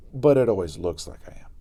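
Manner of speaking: usual speaking voice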